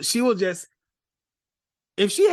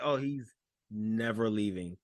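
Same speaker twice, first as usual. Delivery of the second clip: hushed realization; 9 semitones lower, 8 dB quieter than usual. Retold or imitated. Retelling own reaction.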